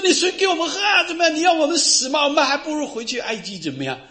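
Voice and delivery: high, loud, hurried protest; acted complaint. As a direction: play a thirsty crowd haranguing its leader. Acting out thirsty people challenging Moses.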